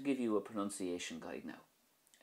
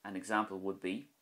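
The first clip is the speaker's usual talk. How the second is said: normal talking voice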